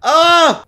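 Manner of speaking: high, loud "ah" of fright; startled scream. Real, not acted. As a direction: cry out at a sudden scare. Jump scare in horror game.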